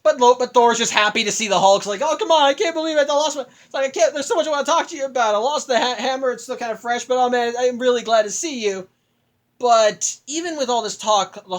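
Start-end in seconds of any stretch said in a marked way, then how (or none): 2.0-8.9 gushing. Retold or imitated. Thor gushing at Hulk.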